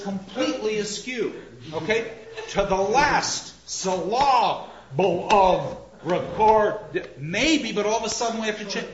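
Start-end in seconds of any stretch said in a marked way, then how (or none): 2.5-7.1 slow, loud beats on "last" and "syllable"; pounded-out meter. Shown as a demonstration. Lecturer showing the meter going askew, pounded-out meter. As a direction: hammer out "last syllable of record" beat by beat. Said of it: "I'll pound it out".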